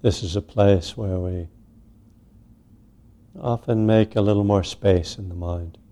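unhurried meditation guidance with long pauses; guided meditation. Real, not acted. Teacher settling a group into sitting meditation, unhurried guidance. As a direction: ease each phrase out, leaving space after it.

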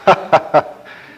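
booming imitated monster laughter; mocking ogre laugh. A narrator's reading. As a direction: laugh scornfully as a storybook ogre. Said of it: "the ogre just laughed".

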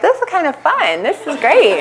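high, cheery imitation of a delighted friend; having fun. Friend enjoying the retreat tells her angry companion, cheerfully high. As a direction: mimic her enjoyment in a lifted, high pitch. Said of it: "She seemed to be having fun".

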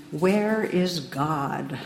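slow, drawn-out stern demand; stern questioning. Retold or imitated. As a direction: stretch each word out like a stern clergyman. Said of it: "he asked him very sternly".

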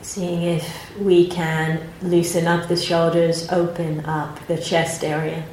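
calm, unhurried meditation guidance with long pauses; guided meditation. Acted. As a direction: gently guide the group into their posture and body.

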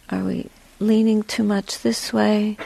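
ordinary talk, nothing marked

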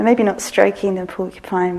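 ordinary talk, nothing marked

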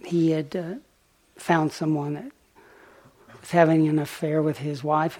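ordinary talk, nothing marked

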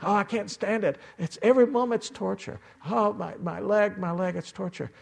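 high, hammed-up whine of torture; mock whining. Teacher mimics a sore meditator's whining thoughts, mock high whine.

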